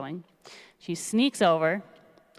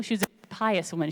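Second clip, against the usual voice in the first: usual speaking voice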